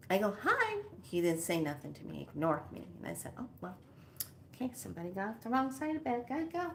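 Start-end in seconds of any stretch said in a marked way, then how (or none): 0.4-0.8 high, chirpy little hello; friendly greeting. Retold or imitated. Retold friendly greeting to neighbor. 3.4-6.7 quick, soft brush-off to oneself; muttered aside. Retold or imitated. Retold aside after being ignored.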